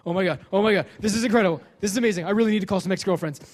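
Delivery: loud, giddy excited yelling; freaking out. Retold or imitated. Comedian reliving Oprah news, loud giddy excitement. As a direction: shout it like you just won the lottery. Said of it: "I'm freaking out".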